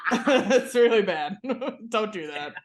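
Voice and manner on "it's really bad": slow, giggly disapproval; laughing. Real, not acted. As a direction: laugh it out, shaking your head.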